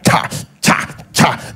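sharp, unvoiced "cha" jabs; stabbing noises. Shown as a demonstration. Pastor imitating how couples talk, in stabbing noises. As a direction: spit out each cha like a knife thrust. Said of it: "most people's talking, they are".

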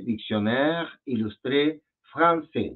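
"Dictionnaire Illustré Français" slowly, the ç as an S; slow French reading of "Dictionnaire Illustré Français", silent final S; French pronunciation. Shown as a demonstration. Teacher explains the cedilla to a student, slow French pronunciation. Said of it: "hace el sonido de una S".

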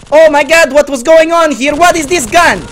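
high, loud, incredulous outburst; real exasperated rant; baffled yelling. Real, not acted. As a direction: shout in disbelief at your weapon. Baffled outburst at gun failing.

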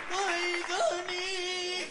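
put-on high swooning girlfriend voice. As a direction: sigh his name like a smitten girlfriend. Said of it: "ella seguía en plan". (voz de novia enamorada, aguda)

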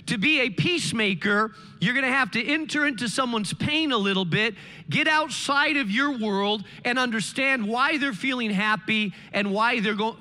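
usual speaking voice

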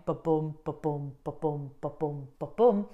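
ba-boom repeated as even heartbeats, stress on boom; steady iambic pulse; heartbeat rhythm. Shown as a demonstration. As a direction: count out five heartbeats. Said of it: "five of those per poetic line".

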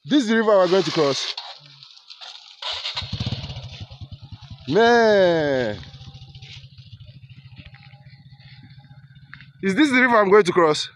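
a real shocked shout at the river; alarmed exclaiming. Real, not acted. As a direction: cry out at the sight of the river. Dismay at river to cross.

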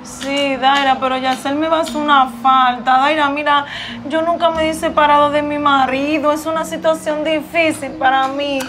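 a hint of a lonely wife's lament in a put-on voice; lightly voiced sad wife. Wife telling her friend she misses her husband, mildly mournful.

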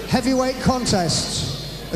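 ordinary talk, nothing marked

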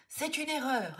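a furious mother shouting herself breathless; woman yelling that it's all a mistake; shouting till breathless. A narrator's reading. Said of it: "s'époumonne la femme".

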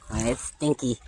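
ordinary talk

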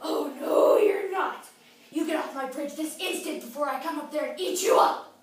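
loud, menacing troll outburst; threatening troll. Acted. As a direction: shout the threat at a little goat. Troll threatens little goat.

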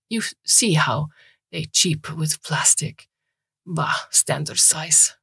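put-on slow Eastern European old man's accent; old Eastern European repairman, slow and scornful of plastic. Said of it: "a thick Eastern European accent".

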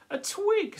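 high, loud, delighted; swan's eager cry. Acted. Swan spotting a twig for her nest, high and delighted. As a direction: pounce on the twig with glee.

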